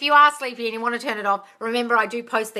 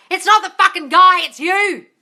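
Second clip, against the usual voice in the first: loud, high exasperated outburst; emphatic scolding; 10 semitones higher, 12 dB louder, slower than usual. Real, not acted. Host scolding viewers who blame their partners, loud exasperated outburst. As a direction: hammer it home, half shouting. Scolding bad-marriage repeaters.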